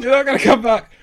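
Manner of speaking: loud, high-pitched imitation of screaming girls; mock screaming. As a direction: yell the warning over and over, hammed-up. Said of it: "two girls screaming over and over".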